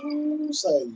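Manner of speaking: high ooh, say; excited whoop. Real, not acted. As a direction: let it fly up in delight. Excited about seasoning.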